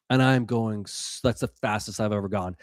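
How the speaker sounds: usual speaking voice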